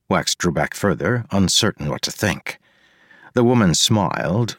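plain reading voice

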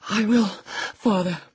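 high, breathless plea; panting. A narrator's reading. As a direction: gasp it out between breaths. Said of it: "he panted".

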